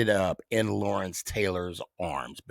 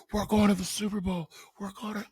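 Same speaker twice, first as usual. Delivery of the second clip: much higher, fast shouting joy; ecstatic celebrating; 12 semitones higher, 4 dB quieter, faster than usual. Retold or imitated. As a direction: yell it like your team just won. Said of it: "I'm, like, celebrating".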